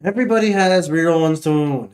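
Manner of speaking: ordinary talk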